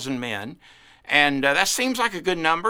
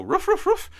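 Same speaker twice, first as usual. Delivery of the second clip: high yappy barks, imitating a dog; dog barking. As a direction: bark it out like Lassie. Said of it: "Lassie could go".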